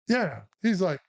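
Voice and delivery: normal talking voice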